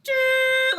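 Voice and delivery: high, loud imitated machine screech; loud machine noise. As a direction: blast out a piercing machine noise. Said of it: "All he hears".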